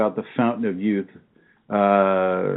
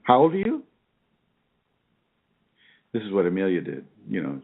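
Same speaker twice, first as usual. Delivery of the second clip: normal talking voice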